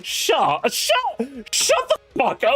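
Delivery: high, slow, laughing outburst; laughing protest. Real, not acted.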